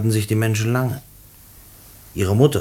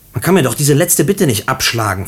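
desperate plea; 5 semitones higher, 6 dB louder than usual. Acted. Prisoner begs last request.

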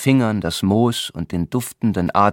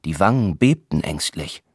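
plain reading voice

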